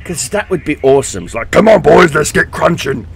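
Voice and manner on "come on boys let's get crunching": loud, hammed-up orc boss rallying the boys; playful orc commander rallying his army. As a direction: shout it like an orc warlord getting his troops going. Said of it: "a voice pack for the orc commander".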